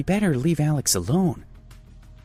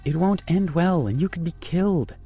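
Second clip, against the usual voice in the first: a little angry; 11 semitones higher than usual. A narrator's reading. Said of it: "getting a little angry".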